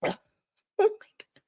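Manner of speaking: very high laughing exclaim; mocking a bad matchup, high squeal; squealed oh my God. Real, not acted. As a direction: squeal it in disbelief.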